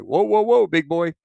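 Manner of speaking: high, loud easy-now call; reining in. Retold or imitated. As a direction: settle him down like a jumpy horse. Pilot reining in the speed.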